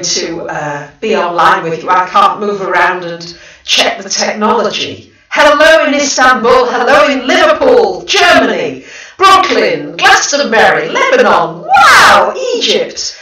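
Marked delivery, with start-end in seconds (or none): 5.3-13.2 loud, high, thrilled roll call of places; real excitement, louder and higher; excited greetings. Real, not acted. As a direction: call out each city like a delighted host. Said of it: "Gosh, I'm so excited".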